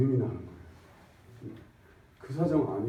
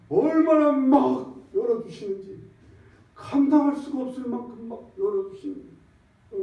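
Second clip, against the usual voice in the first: fervent preaching; 10 semitones higher, 4 dB louder than usual. Real, not acted. Preaching climax on God's opening.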